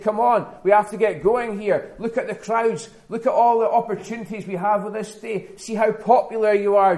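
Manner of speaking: high, loud, insistent pleading; urgent urging. Acted. Disciples seeking Jesus as crowds gather, urgently urging. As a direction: press them eagerly to get moving.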